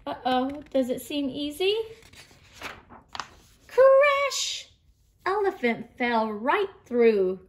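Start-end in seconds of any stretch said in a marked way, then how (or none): 3.7-4.7 high, loud storybook crash effect; sudden cartoon "crash" exclamation. A narrator's reading. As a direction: shout the crash as one held high sound. Elephant falls through the trampoline.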